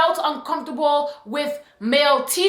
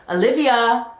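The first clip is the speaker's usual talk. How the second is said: a lightly voiced creepy man calling a name. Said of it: "like, in the creepiest voice".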